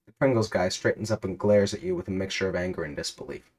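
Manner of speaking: normal talking voice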